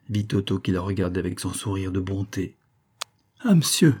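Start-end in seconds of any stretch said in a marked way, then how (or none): 3.4-4.0 higher, eager cry of relief; a sick woman crying out on seeing her visitor. Acted. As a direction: exclaim it, higher pitched. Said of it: "s'écria-t-elle".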